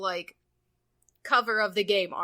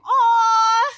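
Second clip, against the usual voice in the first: loud high aww; 10 semitones higher, 9 dB louder than usual. Real, not acted. Reacting to sad game moment.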